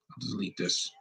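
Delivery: breathy whisper, barely there; whispered aside. Real, not acted. Admitting to viewers he'll cut the rant, a whispered aside. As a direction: mutter it under your breath like a secret. Aside after ranting about British actors.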